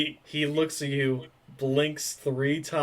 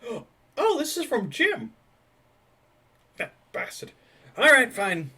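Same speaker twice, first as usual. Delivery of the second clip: gasping surprise; 11 semitones higher, 4 dB louder than usual. Acted. NPC gasps reading note.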